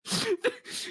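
shrieking high laugh; high burst of laughter. Real, not acted. Friend cracking up at the bit, high squealing laugh. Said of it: "You did this lean back".

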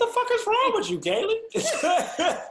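very high, loud laughing squeal; mock outrage. Real, not acted. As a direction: shriek it in disbelief, cracking up. Mock outrage at her gory story.

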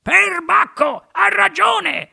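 very high, drawn-out exclamation; amazed. A narrator's reading. Grandpa Joe marvelling at Wonka's sweets, high amazed exclamation. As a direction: agree in high, slow astonishment.

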